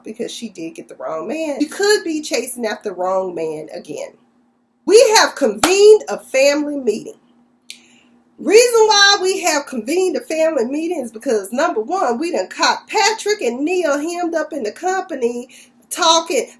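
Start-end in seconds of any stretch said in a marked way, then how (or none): 4.8-16.5 louder, higher formal announcing voice; mock family-meeting announcement. Siblings open their emergency family meeting, loud and pompous.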